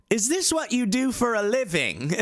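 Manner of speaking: high-pitched mock indignant question; high, put-on celebrity voice; Russell Brand retort. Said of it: "he just goes".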